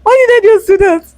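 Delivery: real laughter breaking through the words, pitch shooting up; can't hold the giggles in; laughing. Real, not acted. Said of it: "I'm laughing hard here".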